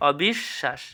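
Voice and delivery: "অবিশ্বাস" as অবিশ্, pause, শাশ; split pronunciation. Shown as a demonstration. Teacher quizzing students, "অবিশ্বাস" split at the sh. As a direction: hold the doubled sh in "অবিশ্বাস". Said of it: "এটা কী হবে".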